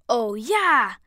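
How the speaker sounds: jubilant loud exclamation; excited cry. A narrator's reading. Brian cheering at the better mood, excited cry. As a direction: cry it out with delight. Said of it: "schrie Brian".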